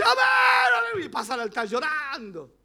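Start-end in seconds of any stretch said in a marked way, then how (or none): 0.0-1.1 high, loud imitated amen; imitated believer's shout; mock shouted amen. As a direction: shout it like a moved church member. Brother shouting at altar.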